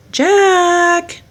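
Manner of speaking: loud high call across town. A narrator's reading. Lady calls out for Jack, loud. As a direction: call his name from afar. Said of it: "a voice calling".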